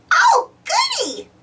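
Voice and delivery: put-on high, squeaky child dinosaur; little dinosaur voice. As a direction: chirp it like an eager toddler. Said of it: "says Baby Bop".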